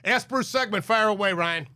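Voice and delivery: ordinary delivery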